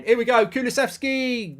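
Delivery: loud, high live commentary; excited match call. Real, not acted. Commentator as Kulusevski attacks for Spurs, excited. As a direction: call the attack with rising anticipation.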